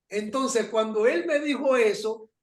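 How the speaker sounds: usual speaking voice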